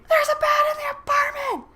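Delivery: high re-enacted shriek; panicked scream. Woman calls Luke about the bat, hysterical acted scream. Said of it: "Again, I scream".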